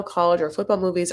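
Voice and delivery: ordinary talk, nothing marked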